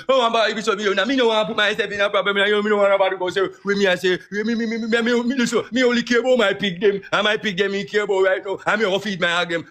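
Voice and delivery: high, flustered imitation of Pops; imitating Pops. Retelling Pops dodging the question, high mock flustered voice. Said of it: "Him say".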